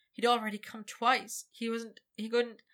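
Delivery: ordinary delivery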